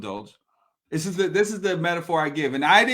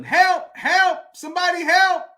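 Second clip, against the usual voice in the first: high, raised retold cries for help; mock yell for help; 10 semitones higher, 5 dB louder than usual. Abandoned man in the hole, mock yelling for help. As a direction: yell up for anyone passing by. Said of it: "Guy starts yelling".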